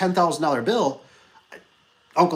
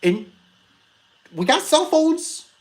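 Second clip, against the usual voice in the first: slow, much higher, disbelieving emphasis; exasperated; 13 semitones higher, slower than usual. Real, not acted. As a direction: spell out the obvious in disbelief. Boss exasperated at worker.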